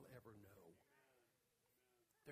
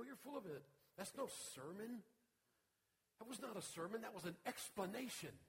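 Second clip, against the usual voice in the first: dismissive retort; 7 semitones higher, 7 dB louder than usual. Retold or imitated. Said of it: "And I go".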